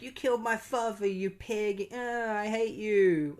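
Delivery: high-pitched mock anguish, last words dragged out; mock distraught outburst. Grieving daughter accuses Mark of killing her father, high mock outburst. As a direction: imitate a wronged daughter wailing accusations.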